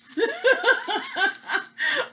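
squealing high laugh; can't hold back a shrieking laugh; high burst of laughter. Real, not acted. Cracks up at own joke.

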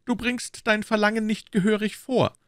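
very high, formal musketeer voice; Aramis. A narrator's reading. Musketeer rejects his friend's demand, high and formal.

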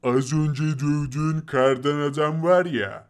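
loom over him; menacing snow monster. Acted. The snow monster taunts Fakir in a low menacing voice.

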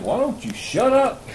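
hammed-up old coach telling him to hush; mock gruff coach. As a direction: coach snapping at the talker. Coach voiced in story.

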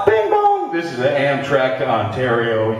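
mock Amtrak PA chime and announcement; train announcement. Drunk comedian asleep on a train, mock Amtrak announcement.

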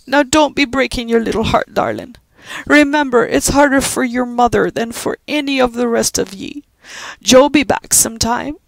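put-on old Irish servant comforting a child; consoling Irish housekeeper. Judy comforting weeping Pat in a brogue.

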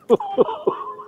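short high laugh; a real laugh after the pint line; laughing at his own line about the balcony; high chuckle. Real, not acted. Laughs after "knock yourself out.

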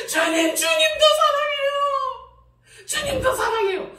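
slow, high, loud sobbing plea; crying wail. Retold or imitated. Preacher reenacting her sobbing love confession to God. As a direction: drag it out, high and wailing.

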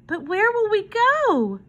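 put-on high, plaintive child's question; little girl. A narrator's reading. Girl asks hobo where they'll go, anxiously. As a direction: ask in a small, worried, high voice. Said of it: "asked Susie".

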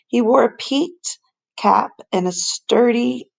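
usual speaking voice

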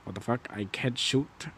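normal talking voice

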